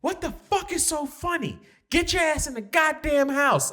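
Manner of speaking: mom yelling. Retold or imitated. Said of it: "she goes".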